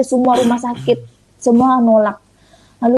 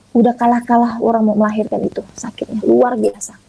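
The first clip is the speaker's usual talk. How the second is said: ordinary delivery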